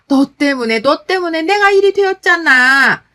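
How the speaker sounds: loud blame, climbing high in pitch; accusatory outburst. Acted. Hospitalized mother-in-law blaming daughter-in-law, accusatory yelling. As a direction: build from accusation into a yell. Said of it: "제 탓을 하셨고요".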